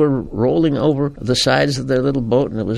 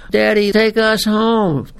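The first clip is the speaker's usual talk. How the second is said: put-on pleading child; 9 semitones higher, 3 dB louder, slower than usual. Child pleading after the storm.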